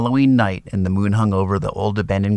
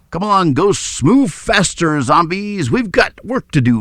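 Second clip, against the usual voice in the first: lightly voiced bossy vampire, higher and louder; commanding; 7 semitones higher, 4 dB louder than usual. A narrator's reading. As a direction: herd the monsters like a strict boss. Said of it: "Ula commanded".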